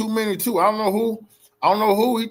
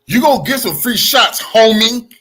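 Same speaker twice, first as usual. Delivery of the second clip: loud taunt; 4 semitones higher, 8 dB louder than usual. Real, not acted. Taunting the wannabe biker.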